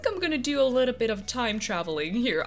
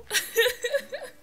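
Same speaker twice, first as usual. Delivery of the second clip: high laugh; 11 semitones higher than usual. Real, not acted. Laughs at bad luck.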